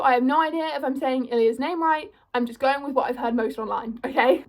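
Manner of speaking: normal talking voice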